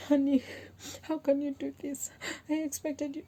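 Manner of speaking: high, whiny pleading voice; mock crying wife. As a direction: play a tearful wife. Acting her past crying self.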